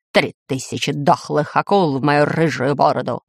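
low, restrained pirate cursing; angry pirate. A narrator's reading. Pirate swearing at the parrot, angry. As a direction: curse like a cross pirate, pitched low. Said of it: "сердито кричал пират".

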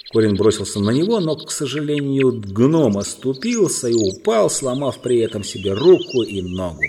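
ordinary delivery, nothing marked

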